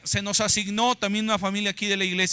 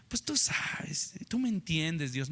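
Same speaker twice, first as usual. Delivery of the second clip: slow, soft confiding murmur; hushed aside; 5 semitones lower, 7 dB quieter, slower than usual. Acted. Mock churchgoer pleading with God, slow hushed aside. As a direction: confide to God under the breath.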